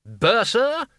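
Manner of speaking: high, loud yell across the hall. Acted. Arch-Chancellor yelling for the bursar after the crash. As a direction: bellow the name to summon him. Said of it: "he yelled".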